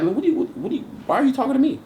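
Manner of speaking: high, fast, bewildered question; baffled and rushed. Retold or imitated. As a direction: rattle it off in disbelief.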